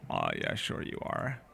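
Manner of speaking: sarcastic. Acted. Host teasing the player who claims to be Swedish, sarcastic. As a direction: drag it down to sound unconvinced.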